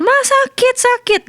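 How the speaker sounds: high wailing child's cry, imitated; child crying in pain. Mother imitating her hurt toddler crying for mama. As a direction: mimic a toddler wailing for mum. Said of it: "dia bilang teriak-teriak".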